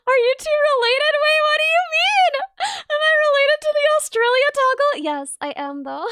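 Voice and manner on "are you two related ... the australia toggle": high, loud playful indignation; mock outrage. Real, not acted. Streamer pretending offence at a chat joke. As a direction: act offended for laughs.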